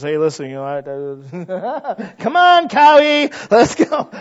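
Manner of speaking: a congregant bursting in, laughing and shouting; excited shout. Retold or imitated. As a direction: burst out laughing and call everyone to go. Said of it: "brother so-and-so walks through those doors and says".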